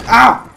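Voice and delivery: loud, high "ow"; short cry of pain; pained yelp. Real, not acted. Player hurt on a drunken ride, pained yelp.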